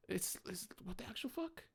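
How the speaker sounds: muted incredulous mock; disbelief. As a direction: breathe it out stunned. Disbelief retold, quieter.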